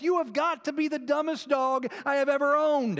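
loud, high, exasperated yell at the dog; retold yelling. Owner yelling at his dog on the doorstep, loud and high. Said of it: "he starts yelling at the dog".

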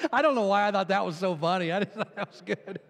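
quick, real laugh through the words; amused at your own joke, breaking into laughter. Real, not acted.

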